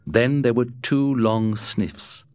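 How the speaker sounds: plain reading voice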